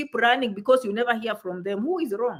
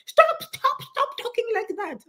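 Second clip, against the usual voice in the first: mock protest; 10 semitones higher than usual. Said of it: "I'm like".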